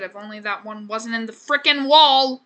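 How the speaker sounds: exasperation bursting out loud; loud grumble at the game; exasperated. Real, not acted. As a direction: build to a loud, annoyed finish. Annoyed at item stuck in wall.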